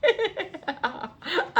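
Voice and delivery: a high, rising giggle at her own pronunciation; amused laugh. Real, not acted. Vlogger laughing at how she said the brand, a high rising giggle.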